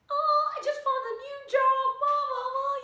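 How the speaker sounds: very high, loud squeal of joy; enthusiastic. Acted. Acting out someone telling mom about a new job, overjoyed. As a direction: shriek it in a high, singsong rush. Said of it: "the enthusiasm".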